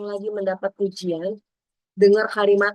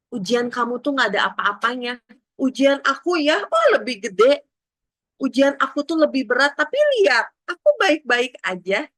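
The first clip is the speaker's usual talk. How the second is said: boastful one-upping; 4 semitones higher, 4 dB louder than usual. Retold or imitated. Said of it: "orang-orang akan adu nasib".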